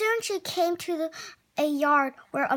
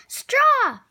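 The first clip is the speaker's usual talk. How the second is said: loud, much higher shout; boy shouting at his sister in an argument; 10 semitones higher, 9 dB louder than usual. A narrator's reading. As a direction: hurl the word back at his sister. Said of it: "shouted the boy".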